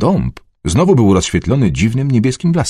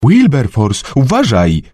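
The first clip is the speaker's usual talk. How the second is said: called-out warning; 3 semitones higher, slower than usual. A narrator's reading. Amy warning Wilberforce from over the railing, calling out. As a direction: call a warning down, without a full shout. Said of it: "zawołała w dół ponad poręczą schodów".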